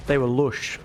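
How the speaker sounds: "They were lush" with a broad northern English "lush" rhyming with "push"; northern accent. Shown as a demonstration. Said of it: "I read it in a really northern accent in my head".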